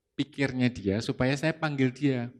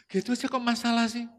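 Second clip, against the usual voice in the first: higher-pitched, dismissive sour-faced retort; imitated annoyance; 9 semitones higher, faster than usual. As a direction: brush off the complaint with a scowl. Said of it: "Sambil mukanya nggak enak ini".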